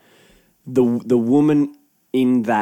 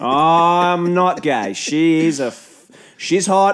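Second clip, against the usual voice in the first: slow, loud macho boast; blokey guy; 5 semitones higher, 5 dB louder, much slower than usual. Retold or imitated. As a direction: play the macho bloke, drawn out. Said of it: "Look how blokey I am".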